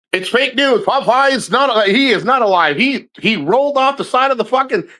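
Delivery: high-pitched fake news bulletin; mock insistence. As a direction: insist on the joke, pitched well up. Joke report of Popeye's death.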